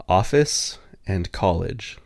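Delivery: slow, careful "Office and college"; said slowly on request. Shown as a demonstration. Asked to repeat, slow careful words. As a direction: say each word deliberately for the listener. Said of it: "Can you say them again for me?".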